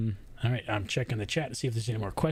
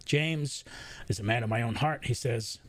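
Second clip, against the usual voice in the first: ordinary talk, nothing marked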